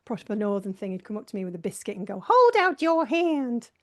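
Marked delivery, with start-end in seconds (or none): 2.2-3.7 put-on high, loud, slow old granny; old granny impression. Friend offering a biscuit at break, high slow granny voice. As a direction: hold out the offer like a bossy granny. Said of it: "doing an impression of that old granny in".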